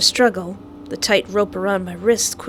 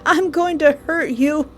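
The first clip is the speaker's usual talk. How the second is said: mock tearful threat; 8 semitones higher than usual. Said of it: "I'm crying because".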